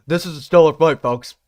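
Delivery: loud mock British accent. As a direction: announce the stellar fight in a British accent. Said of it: "let's read this normally".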